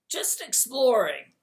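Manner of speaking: high, loud, slow little fire-demon voice; small crackling voice. Acted. Calcifer answering the king in a small crackling voice. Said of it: "in his small, crackling voice".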